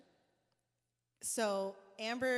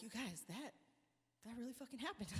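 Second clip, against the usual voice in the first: much softer, hushed awe; awed hush; 11 dB quieter than usual. Retold or imitated. Band stunned after the session, hushed awe. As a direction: breathe it in disbelief.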